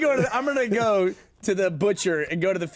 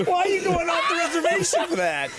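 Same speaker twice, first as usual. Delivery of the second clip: laughing shout; 11 semitones higher than usual. Real, not acted. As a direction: yell it while cracking up. Shouting through laughter at fish answer.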